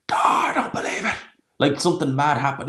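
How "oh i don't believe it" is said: high, loud, breathy mock roar of disbelief; imitating a wild old-school commentator's roar; dramatic commentator roar. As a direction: belt it like a darts commentator losing his mind. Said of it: "he just lets this roar out going".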